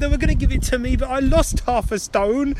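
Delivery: hammed-up high-pitched complaint; mock lament. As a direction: bemoan it in a high, slowed voice. Mock lament over losing weight.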